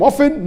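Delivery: "often" with no T sound; silent T. Shown as a demonstration. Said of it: "Some say T is silent".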